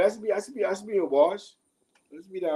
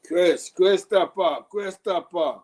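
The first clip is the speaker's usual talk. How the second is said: loud, exasperated call of the name; 5 semitones higher, 7 dB louder, slower than usual. Real, not acted. To a viewer getting his story wrong, a loud exasperated call. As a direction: call him out, fed up.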